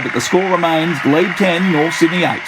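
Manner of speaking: ordinary talk, nothing marked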